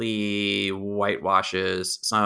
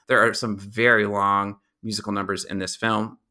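normal talking voice

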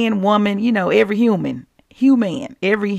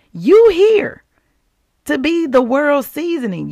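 higher, louder preaching burst; emphatic exclaim; 6 semitones higher, 3 dB louder than usual. Acted. Preacher bursting out to the congregation with emphatic joy. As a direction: hit it like a revelation.

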